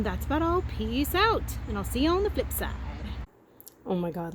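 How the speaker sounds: ordinary talk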